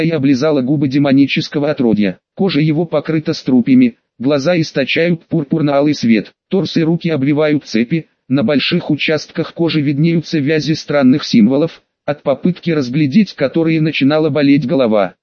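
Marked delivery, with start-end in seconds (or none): none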